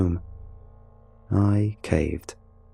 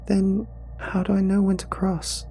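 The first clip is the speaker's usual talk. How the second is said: put-on high, small tearful boy; a lost kid on the verge of tears; sniffling child; 11 semitones higher than usual. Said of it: "he sniffled".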